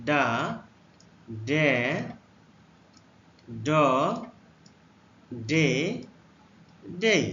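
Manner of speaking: teacher modelling ডা ডে ড ডে ডেই; letter sounds demo. Shown as a demonstration. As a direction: model ডা ডে ড ডে ডেই with a pause after each. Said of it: "ডি এ".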